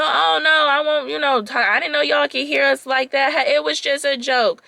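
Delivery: high, loud sarcastic fake apology; fake-sorry smirking bro; imitating the date's sarcastic apology; mock frat boy. Frat boy jerk mocking apology.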